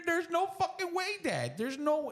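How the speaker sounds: very high-pitched mock child protest; squeaky-high incredulous outburst; disbelieving kid. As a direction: imitate a kid refusing to believe it. Acts out kids' disbelief.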